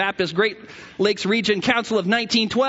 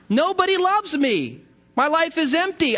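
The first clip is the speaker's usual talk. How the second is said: higher whiny lament; mock self-pity; 7 semitones higher than usual. Lonely man moaning to the narrator, higher and whiny. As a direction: moan it, higher and pitiful.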